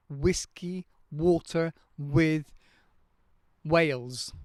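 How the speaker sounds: "Whiskey, water, with, Wales" each with a strong rounded W; emphasized W. Shown as a demonstration. Teacher drilling students on a strong W sound. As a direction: push the lips forward on every W. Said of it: "emphasize a W sound".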